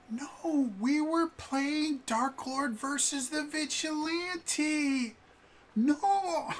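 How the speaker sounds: put-on very high, loud child's protest, easing on the final word; whining kid. Kids to their mom, half-heard argument, high whiny protest. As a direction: argue back like a kid answering a parent. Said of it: "they have a conversation".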